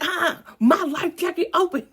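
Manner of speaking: high, loud mock panic; freaking out. Telling how she answered those calming her, loud high mock panic. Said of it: "freaking out".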